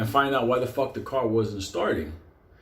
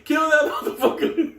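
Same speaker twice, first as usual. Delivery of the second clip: very high-pitched mock yell; high, frantic imitation; retold frantic Mikey; 12 semitones higher, 3 dB louder than usual. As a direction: squeal the order in comic desperation. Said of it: "Fucking Mikey was like".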